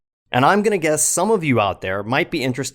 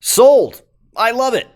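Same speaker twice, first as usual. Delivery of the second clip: excited shout; 10 semitones higher, 8 dB louder than usual. Real, not acted. Host delighted by the silly plot.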